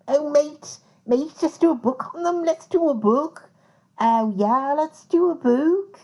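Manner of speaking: put-on high, chummy Liverpudlian accent, mate-style. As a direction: imitate a Scouse lad pitching the idea in Liverpudlian accent. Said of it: "that Liverpudlian accent".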